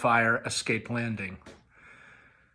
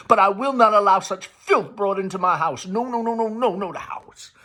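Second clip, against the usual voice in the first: higher, louder outraged refusal; furious mother; 7 semitones higher, 4 dB louder than usual. A narrator's reading. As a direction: forbid it in the house. Amanda furious at Tom.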